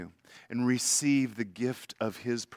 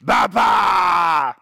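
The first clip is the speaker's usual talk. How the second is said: little granddaughter's loud, high growling shout; growling little girl; 8 semitones higher, 15 dB louder than usual. Retold or imitated. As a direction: roar it out like an excited little girl. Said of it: "she gets this growl in her voice".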